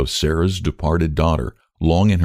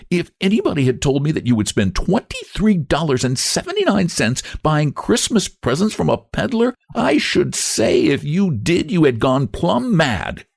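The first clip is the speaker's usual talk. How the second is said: Lottie voice; 10 semitones higher than usual. A narrator's reading.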